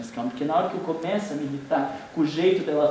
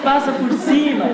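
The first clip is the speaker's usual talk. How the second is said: louder, higher yell of Passa por cima with cima falling hard; mock angry shout; 8 semitones higher, 6 dB louder than usual. Angry driver waving tailgater past, mock shout. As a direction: bark it out like waving the car past. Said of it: "a gente também fica com raiva".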